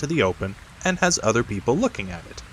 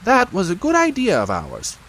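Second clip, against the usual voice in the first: high, self-pleased rabbit voice; 11 semitones higher than usual. A narrator's reading. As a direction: sound pleased with yourself, pitched up.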